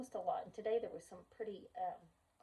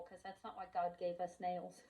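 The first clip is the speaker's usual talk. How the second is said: usual speaking voice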